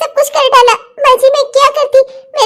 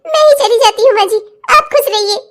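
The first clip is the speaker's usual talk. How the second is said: tearful pleading. Acted. Daughter-in-law begging mother-in-law not to leave, tearful pleading. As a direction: protest dissolving into tears.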